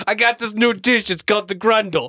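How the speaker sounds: high, loud hammed-up Italian-American accent; mock Italian-American. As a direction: brag like a New York deli owner in Italian-American accent. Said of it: "we can do it Italian".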